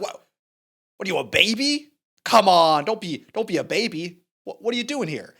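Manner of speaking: loud, taunting imitation of a teenage heckler; mock heckling. Young limousine driver needling the cop, mock heckling. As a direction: goad him, jumping up in pitch and volume. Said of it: "he starts heckling you".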